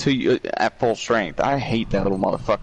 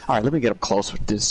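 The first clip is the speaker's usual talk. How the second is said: usual speaking voice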